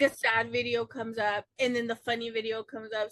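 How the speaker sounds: usual speaking voice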